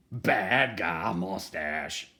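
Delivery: slow, loud, drawn-out reveal of "bad guy mustache"; dramatic reveal. Reading to kids, revealing the villain's mustache with slow drama. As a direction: stretch "bad guy mustache" out slowly for suspense.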